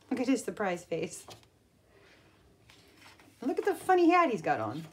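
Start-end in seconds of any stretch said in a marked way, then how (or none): none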